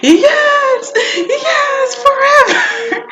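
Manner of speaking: high squealed yell, last word stretched; freaked-out fangirl. Retold or imitated. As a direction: re-enact screaming your devotion at the stage. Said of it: "I freaked out".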